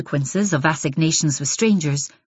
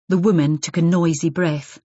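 plain reading voice

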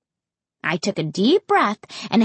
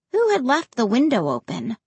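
plain reading voice

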